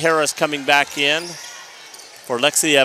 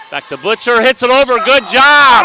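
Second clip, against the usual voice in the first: loud rising excitement; excited shout; 9 semitones higher, 13 dB louder than usual. Real, not acted. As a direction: call the rally loudly. Live rally, excited call.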